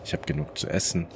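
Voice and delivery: usual speaking voice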